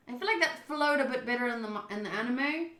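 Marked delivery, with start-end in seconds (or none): none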